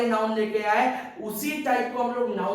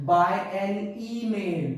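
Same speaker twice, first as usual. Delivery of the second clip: slow, drawn-out "by an email" as the wrong form; slow wrong example; much slower than usual. Shown as a demonstration. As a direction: stretch out "by an email" slowly to show the mistake. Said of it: "मैं ऐसे नहीं बोल सकता". (धीमा, खींचकर "by an email" गलत उदाहरण की तरह)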